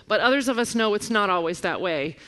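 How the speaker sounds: ordinary delivery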